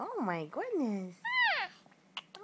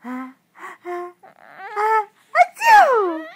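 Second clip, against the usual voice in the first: drawn-out ah ah building to a loud, high, hammed-up achoo; playful mock sneeze, much louder; fake sneeze; 6 semitones higher, 16 dB louder, slower than usual. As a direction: ham up a big pretend sneeze to make the baby laugh. Parent fakes sneezes for baby.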